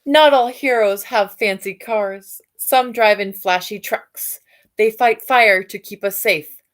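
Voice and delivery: ordinary delivery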